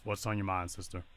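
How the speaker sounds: normal talking voice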